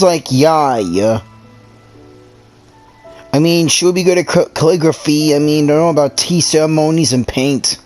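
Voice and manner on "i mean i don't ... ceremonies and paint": ordinary talk, nothing marked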